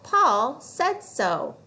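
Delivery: "Paul said so" slow with weight on "Paul"; slow fluency read. Shown as a demonstration. Teacher reading a fluency phrase slowly. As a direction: model "Paul said so" slowly, stressing "Paul". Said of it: "Last one".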